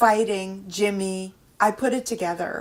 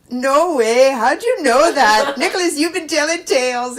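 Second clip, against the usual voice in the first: much higher and louder, laughing disbelief; astonished denial; 8 semitones higher, 14 dB louder than usual. Retold or imitated. Nurse caught out by the mother, shrieking in disbelief. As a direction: squeal it, caught out.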